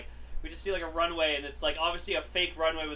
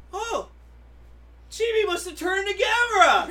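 high, loud amazed discovery; excited kid; 12 semitones higher, 8 dB louder than usual. Retold or imitated. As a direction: gasp it out like a thrilled kid. Kid's excited realization.

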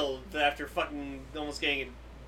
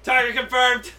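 loud, raised mock battle call; mock announcement; 5 semitones higher, 7 dB louder than usual. Podcaster joking about hunting Amazons for fun, mock announcing. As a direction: call it out like a hunter spotting prey.

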